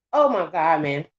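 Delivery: plain reading voice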